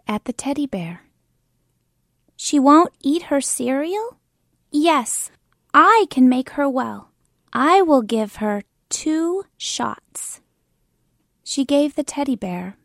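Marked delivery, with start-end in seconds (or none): 2.4-4.2 higher and louder, a pretend doctor checking; a little girl playing doctor, a touch important. Kim as pretend doctor repeating Anna's worry, louder. 4.7-10.4 louder, self-important little doctor; pretend doctor. Kim as pretend doctor promising shots, louder and sure.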